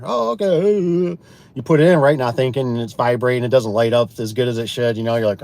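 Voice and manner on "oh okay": high, drawn-out "okay"; acted giving in, stretched out; mock reluctant agreement. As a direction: go along with it in a slow, raised voice.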